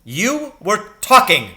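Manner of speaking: loud, high and slow bark; slow, booming accusation. Acted. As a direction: snap it at the pupil like an officer. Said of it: "he barked".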